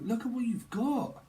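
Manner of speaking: high, amazed exclamation; mock amazement. Quoting a voice she hears, high mock amazement. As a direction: gasp it out in mock wonder.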